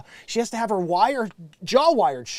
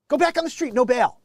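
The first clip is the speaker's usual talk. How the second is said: much higher, sneering mock judge; high-pitched scornful parody of the ruling; 6 semitones higher, 3 dB louder than usual. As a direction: mimic a flippant judge waving him off. Mocking the judges again.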